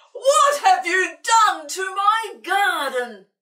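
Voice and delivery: high, loud, slow furious shriek. Acted. Old Sid shrieks at Hamish over his garden. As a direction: shriek it at the cat in outrage. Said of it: "he shrieked".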